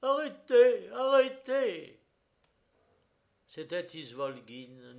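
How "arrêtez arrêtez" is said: high, very loud command; imperious shout. A narrator's reading. A man halts the gendarmes before the church, shouting imperiously. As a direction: shout it imperiously, stretching the last word. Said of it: "une voix cria impérieusement".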